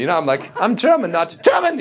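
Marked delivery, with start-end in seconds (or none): 0.6-1.1 high, friendly German introduction; nice German. Shown as a demonstration. Telling fans he is the nice kind, high sweet voice. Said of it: "I'm, like, a nice German". 1.1-1.8 high, hard German bark on GERMAN; menacing German. Shown as a demonstration. Showing fans the wrong kind of German, harsh German accent. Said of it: "I'm not that kind of German".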